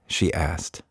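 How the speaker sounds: plain reading voice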